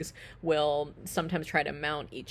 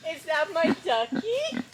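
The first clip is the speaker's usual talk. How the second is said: high, louder, slow coo; doting pig-owner greeting; 12 semitones higher, 5 dB louder, slower than usual. Real, not acted.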